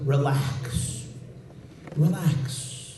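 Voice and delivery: soft, quiet reassurance; calming. Acted. Chaim Yankel calming the leader, soft reassurance. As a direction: soothe the panicked leader.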